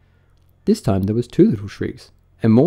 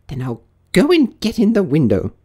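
rabbit talking to itself; 9 semitones higher, 4 dB louder than usual. A narrator's reading. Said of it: "heard it say to itself".

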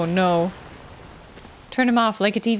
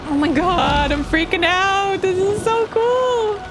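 climbing higher, louder, giddy; thrilled; 10 semitones higher, 3 dB louder than usual. Real, not acted. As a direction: let the excitement build to a high squeal. Player thrilled while fighting.

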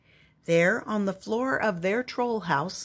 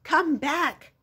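high, loud calling out; yelling after it; 10 semitones higher, 5 dB louder, much slower than usual. Acted. A child yelling at the fleeing hedgehog. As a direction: shout after the runaway pudding. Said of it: "so I yelled".

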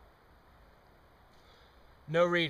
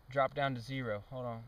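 ordinary talk

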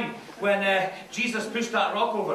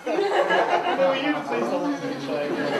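recorded phone message; 3 semitones higher, slower than usual. Retold or imitated. Repeating recorded message, louder.

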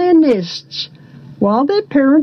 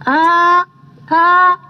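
crow; 2 semitones higher, slower than usual. A narrator's reading. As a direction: crow caws, the last stretched long. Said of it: "said he".

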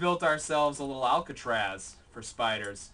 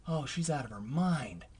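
normal talking voice

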